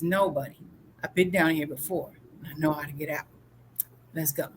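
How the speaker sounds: low, softening voice, quietly sure; calm reassurance. Acted. Joe to his panicking buddy, calm reassurance.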